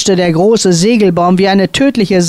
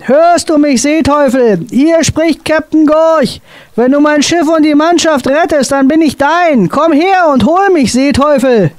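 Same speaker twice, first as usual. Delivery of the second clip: desperate calling out; 9 semitones higher than usual. A narrator's reading. Captain calls out, offering himself to save his ship. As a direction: shout the offer over the wind.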